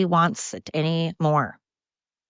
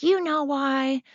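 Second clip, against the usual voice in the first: menacing movie quote; 9 semitones higher, slower than usual. Retold or imitated. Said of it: "she's like".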